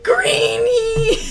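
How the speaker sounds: high, loud mock wail of a name; high, loud, laughing wail; yelled at top of lungs. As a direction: bellow it through laughter like a grieving cry. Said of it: "at the top of his lungs".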